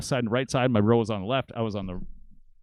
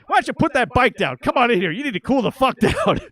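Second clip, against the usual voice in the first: high, loud commanding tone; dad ordering the stranger to calm down; 11 semitones higher, 6 dB louder than usual. Retold or imitated. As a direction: tell him to calm down, loudly. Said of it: "my dad goes".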